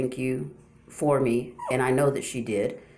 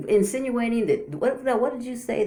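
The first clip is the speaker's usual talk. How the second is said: incredulous sarcasm; 9 semitones higher than usual. Real, not acted. Mocking a rival's claim.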